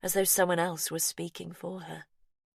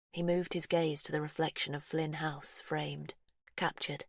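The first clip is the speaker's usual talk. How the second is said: usual speaking voice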